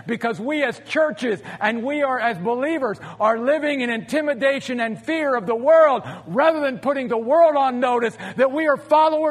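rousing, shouted preaching; rally speech. Acted. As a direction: build to a fiery rallying cry. Sermon climax, shouting conviction.

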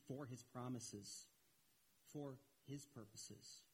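ordinary talk

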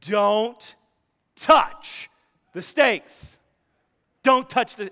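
loud, high warning to the dog; firm shouted no to the dog; stern command to dog. Retold or imitated. Owner warning the dog off the steaks, loud and stern. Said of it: "and I said".

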